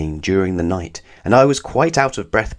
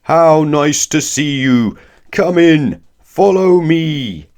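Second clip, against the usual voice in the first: loud, slow giant's boom, pitched higher; 8 semitones higher, 7 dB louder, slower than usual. Acted. Giant wizard booms a welcome at his door. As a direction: fill the hall like a giant. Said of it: "he boomed in a voice".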